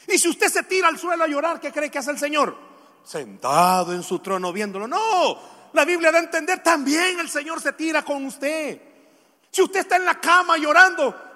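high, loud pulpit voice; fervent preacher in full flow; impassioned preaching. Real, not acted. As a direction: challenge the congregation forcefully. Preaches with force.

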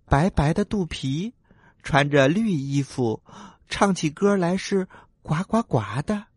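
small, earnest questioning voice; polite little tadpole. Acted. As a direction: list her features politely, like a little one. Tadpole asks frog.

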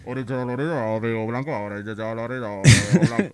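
imitated police loudspeaker order; policía por altavoz. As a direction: sound like a patrol megaphone pulling a car over. (imitando al policía por altavoz)

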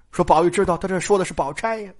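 plain reading voice

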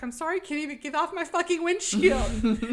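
high, loud, hammed-up outburst; exasperated yell. Driver yelling at the man on her windshield, exasperated.

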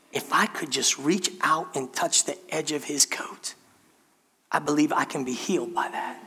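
breathless hushed whisper of a hopeful woman; inner-thought whisper. Retold or imitated. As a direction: whisper it like a secret thought you barely dare to hope. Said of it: "and this woman thinks".

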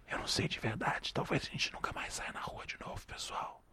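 whispered confession. Real, not acted. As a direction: whisper so the dog can't hear. Confides the truth away from dog.

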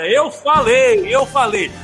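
loud, slow told-you-so; excited. Real, not acted. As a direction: gloat it out loud. Commentator gloating after predicted goal.

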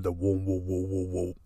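low, soft wobbling whoa of a hovering UFO; sci-fi saucer noise, quietly; flying saucer hum. Retold or imitated. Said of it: "a flying saucer hovering overhead".

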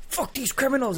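high-pitched pumped-up viewer; all hyped up. Acted. TV viewer cheering on the cops, hyped up. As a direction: psych yourself up, pitch jumping high. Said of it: "You're getting all hyped up".

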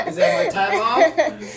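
high laughing question. Real, not acted. Laughing at doorbell interruption.

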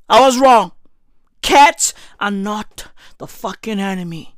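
normal talking voice

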